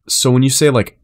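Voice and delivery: ordinary talk, nothing marked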